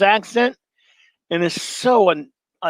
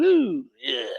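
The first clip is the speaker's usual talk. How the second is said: attempted Justin Wilson; 3 semitones lower, 5 dB quieter than usual. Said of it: "I can't do Justin Wilson".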